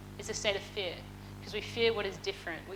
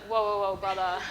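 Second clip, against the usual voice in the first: laughing protest; 4 dB louder than usual. Retold or imitated. Balking at bungee jump request.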